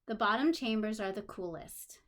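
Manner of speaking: ordinary talk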